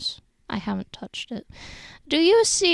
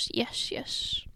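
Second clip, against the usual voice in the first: whispered yes; 8 dB quieter than usual. Real, not acted. Whispered reply.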